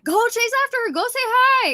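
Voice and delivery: eager friend. Retold or imitated. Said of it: "My friend was like".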